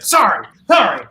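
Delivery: imitated apology, high and loud; Richard Kind. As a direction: blurt it out in a mock yelp. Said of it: "And he's like".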